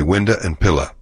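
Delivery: "window and pillow" end in "-uh": "winduh", "pilluh"; Texan window. Shown as a demonstration. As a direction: swap the final O for an A sound in "window and pillow". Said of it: "take the final W off".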